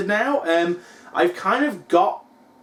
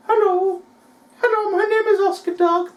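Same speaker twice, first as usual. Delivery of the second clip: much higher, squeaky little dog voice; dog's voice; 13 semitones higher than usual. Pretend. Owner voicing his dog Oscar to the fans, high cute dog voice. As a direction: speak for the dog as a cute pet. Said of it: "He's like".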